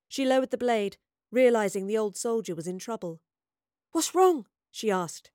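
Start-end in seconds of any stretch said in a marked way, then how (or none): 3.9-4.5 high, louder urgent question; alarmed question. A narrator's reading. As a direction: ask with sudden concern, voice raised. Astrid alarmed at choking man.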